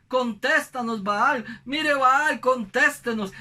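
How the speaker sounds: imitated pleading call. Acts out prophets begging Baal.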